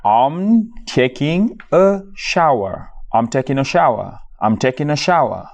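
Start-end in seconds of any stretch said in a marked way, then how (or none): 0.1-2.9 slow model phrase. Shown as a demonstration. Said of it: "teacher models the phrase".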